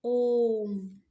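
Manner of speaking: ओम held long and sounded out; prolonged om. Shown as a demonstration. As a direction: draw out ओम to triple length. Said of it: "हम ओम करके बोलेंगे तो हमारा तीन गुना समय".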